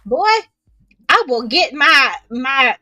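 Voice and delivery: mock-threatening Southern mama telling off a boy; Southern scolding. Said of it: "you're talking Southern right now".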